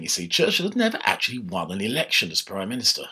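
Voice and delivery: ordinary delivery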